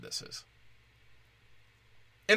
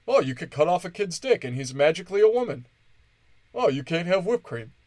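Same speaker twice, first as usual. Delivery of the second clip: higher, louder sneering mock voice; mocking sarcasm; 5 semitones higher, 5 dB louder than usual. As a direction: parody them with scorn. Mocking Democrats' view.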